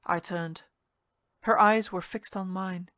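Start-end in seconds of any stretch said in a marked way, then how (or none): none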